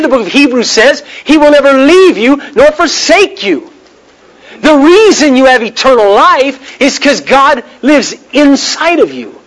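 high-pitched, insistent sermon delivery; emphatic preaching. Real, not acted. Preacher assuring believers of eternal life, impassioned emphatic preaching.

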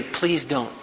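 soft, polite plea; softened request. Shown as a demonstration. Child to a brother or sister who hit them, softened request. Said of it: "It always softens the don't".

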